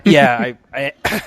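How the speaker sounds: choking on a drink, coughing; coughing up water. Real, not acted. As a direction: sputter through a coughing fit. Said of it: "you're choking over there".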